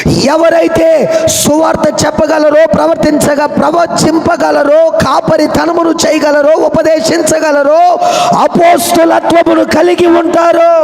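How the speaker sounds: lifted, sing-song sermon cadence; high-pitched preaching. Acted. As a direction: preach it out to the congregation, slow and high.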